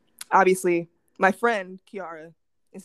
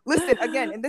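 ordinary talk

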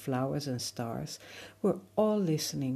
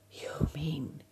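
breathy airy whisper; whispering wind; 5 dB quieter, slower than usual. Acted. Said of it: "the wind said".

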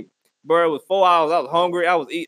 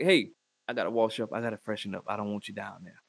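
low, much softer murmur; discreet warning; 12 semitones lower, 11 dB quieter than usual. Retold or imitated. Telling partner to wait, low soft murmur. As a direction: drop it low and private.

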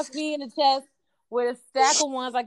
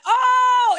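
shocked shout; 14 semitones higher, 7 dB louder than usual. Retold or imitated.

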